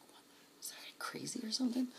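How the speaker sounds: hushed whisper of disbelief; retold whisper. Friends whispering as Catfish rolls up, hushed. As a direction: whisper it so he can't hear.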